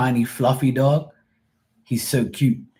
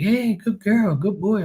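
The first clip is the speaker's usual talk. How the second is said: high dog-petting coo; 11 semitones higher than usual. Retold or imitated.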